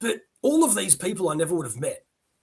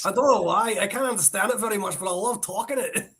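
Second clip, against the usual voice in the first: quick, higher Scottish lilt; Scottish accent; 5 semitones higher, faster than usual. Shown as a demonstration. Showing off the favorite accent, high quick Scottish. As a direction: chatter in a put-on Scottish accent. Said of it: "I really enjoy doing the Scottish accent".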